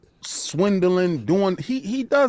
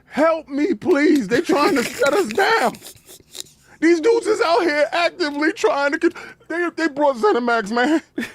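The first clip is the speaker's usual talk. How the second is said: mock desperate plea; 7 semitones higher than usual. Voices Phil begging other countries.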